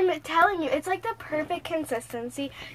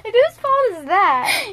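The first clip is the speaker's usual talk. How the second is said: loud, slow pointed comeback; sarcastic retort; 7 semitones higher, 11 dB louder, slower than usual. Retold or imitated. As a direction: snap it back sarcastically. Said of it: "I was like".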